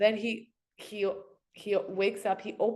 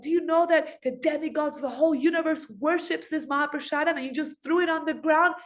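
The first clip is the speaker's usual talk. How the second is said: put-on furious older brother; 5 semitones higher than usual. Baladev threatens the rickshaw man, high furious shout. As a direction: rage at him for insulting the offering. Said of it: "slapping me and saying".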